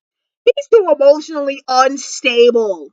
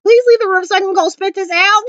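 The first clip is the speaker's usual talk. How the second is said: fake supportive voice; 8 semitones higher than usual. Magnus hiding disgust from Alec, mock cheerful and high. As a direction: sweetly pretend the food is great. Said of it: "that supportive voice".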